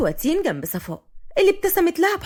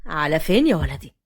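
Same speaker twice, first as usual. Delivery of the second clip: lowered voice of a family elder; grandfather; 9 semitones lower than usual. A narrator's reading. Grandfather to the latecomers, low questioning.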